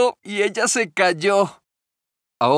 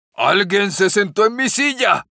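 lightly voiced big bear exclaiming; a hint of the big bear's booming voice; big bear, loud and hoarse; 4 semitones higher, 3 dB louder than usual. A narrator's reading. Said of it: "con su voz fuerte y ronca". (el oso grande, apenas insinuado)

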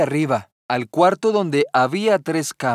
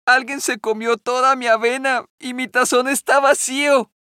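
put-on baby bear's voice; 8 semitones higher than usual. A narrator's reading. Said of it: "dijo el osito".

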